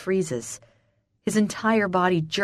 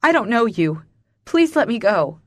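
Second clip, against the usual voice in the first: higher, louder pleading; distressed and urgent, voice raised; panicked plea; 4 semitones higher, 5 dB louder than usual. A narrator's reading. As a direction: beg to be released. Panicked woman pleads to be released.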